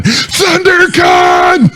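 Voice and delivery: high, drawn-out taunting yell; re-enacted street shout. Calling out along street, retold.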